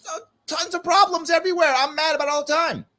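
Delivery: high, whiny griping voice; mock complainer. Speaker mocks the kind of griping American he refuses to be. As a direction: parody a constant complainer. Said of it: "I'm not gonna sit here and say".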